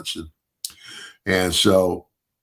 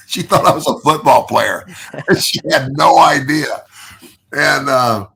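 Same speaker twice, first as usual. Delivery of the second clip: laughing; 6 semitones higher, 6 dB louder than usual. Real, not acted. Laughing at the memory.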